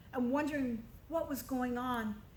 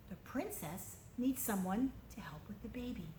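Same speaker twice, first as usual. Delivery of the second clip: ordinary delivery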